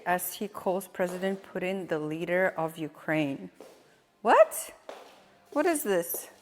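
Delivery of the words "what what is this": sharp high disbelieving what; shocked. Real, not acted. Streamer reacting to a Biden clip, high and shocked. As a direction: blurt it out in disbelief.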